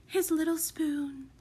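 high, wistful lady's murmur. A narrator's reading. Mother remembering her stolen baby, wistful murmur. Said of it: "she murmured".

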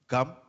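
attempted short New York "gum"; New York pronunciation. Husband attempting his wife's short New York "gum". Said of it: "the way she says gum".

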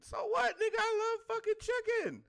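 high-pitched, loud, indignant retort; incredulous, high-voiced protest; exasperated comeback. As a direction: jump way up in pitch and fire back. His loud comeback to the complainer.

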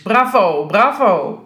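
mock applause in a sarcastic tone; drawn-out ironic praise. A narrator's reading. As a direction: cheer him on with obvious sarcasm. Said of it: "Koning zei sarcastisch".

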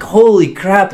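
animated, amazed quote; retold amazement. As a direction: replay being floored by the news. Retelling own astonishment at the answer.

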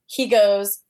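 ordinary talk, nothing marked

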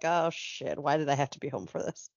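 low, soft grumble to oneself; quiet dismay. Acted. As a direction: grumble it low from behind a newspaper. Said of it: "he'd just be like".

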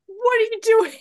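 squealed mock exasperation; mock exasperated cry. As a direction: cry out as if scolding clueless characters. Acted outburst at oblivious book characters.

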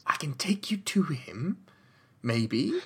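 slow whisper. Acted. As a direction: whisper it cautiously. NPC offers quietly.

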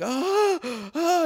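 high mock scream of fright; imitated scream. Girlfriend's jump-scare scream, imitated high. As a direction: squeal it like a startled girlfriend. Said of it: "she was screaming and shit".

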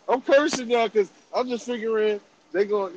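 ordinary talk

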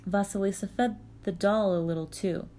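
ordinary delivery

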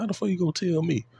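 high, quick indignant retort. Retold or imitated. To a LeBron fan doubting him, an indignant high retort. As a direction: fire it back, offended. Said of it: "I'm like".

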